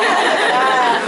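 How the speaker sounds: loud burst of laughter. Real, not acted. Speaker laughs.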